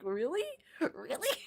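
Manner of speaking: high, rising incredulous question; amused disbelief. Real, not acted. Streamer reacting to accusation of losing for views, incredulous.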